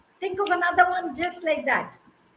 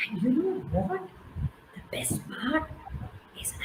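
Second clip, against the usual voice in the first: whisper; 6 dB quieter than usual. A narrator's reading. Building suspense for the kids.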